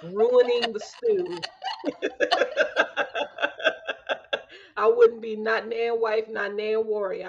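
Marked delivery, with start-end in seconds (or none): 1.4-4.4 airy silent laugh; wheezing laugh; breathless laugh. Real, not acted. Laughing at ruining stew.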